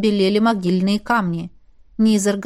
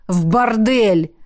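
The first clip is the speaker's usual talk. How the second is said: slow, relished reveal; gloating exclamation; 3 dB louder, slower than usual. A narrator's reading. Man exposing where the rival went, gloating. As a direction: draw the word out with malicious glee. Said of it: "злорадно воскликнул Джаф".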